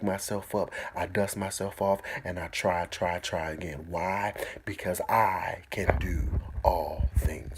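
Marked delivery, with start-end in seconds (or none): 4.6-7.6 slow whispered refrain. Shown as a demonstration. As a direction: whisper it slowly to close. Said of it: "sermon refrain closing".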